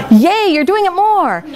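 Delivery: mock cheer. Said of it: "mock cheer at student".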